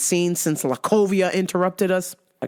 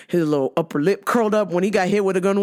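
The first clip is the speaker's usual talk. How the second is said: ordinary talk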